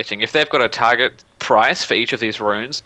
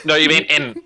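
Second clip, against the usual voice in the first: ordinary talk, nothing marked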